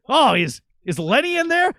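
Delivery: a confused stranger at the door; stranger asking. Retold or imitated. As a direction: stranger on the bike asking for Lenny. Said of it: "he says".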